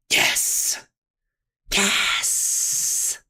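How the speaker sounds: voiceless, hissed celebration; whispered triumph. Real, not acted. Streamer savours rolling a six, exultant whisper. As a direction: whisper it with drawn-out relish after the screaming.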